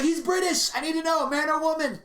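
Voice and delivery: high, pressing question; lightly voiced Tom Arnold needing the answer; urgent curious Tom Arnold. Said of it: "he's like".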